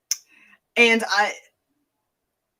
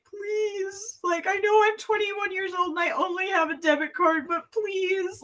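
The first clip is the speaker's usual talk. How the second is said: high, pleading, imitating tears; a hint of a crying beg; mock sobbing plea; 11 semitones higher, 3 dB quieter than usual. Begging the rental counter clerk, mock sobbing.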